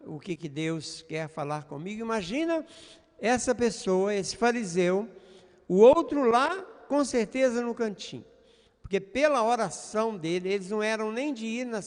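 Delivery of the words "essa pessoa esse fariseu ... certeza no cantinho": ordinary delivery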